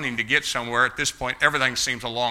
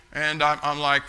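ordinary talk

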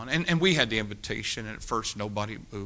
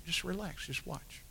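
soft reassurance; 4 semitones higher, 12 dB quieter than usual. Retold or imitated. Calming the nervous young man.

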